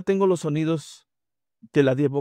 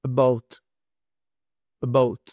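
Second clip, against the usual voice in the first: "about" with a weak first syllable; stress on second syllable; 2 semitones lower than usual. Shown as a demonstration. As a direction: reduce the "a" and lean on "bout". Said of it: "La acentuación realmente está en esta parte". ("about" con el acento en "bout")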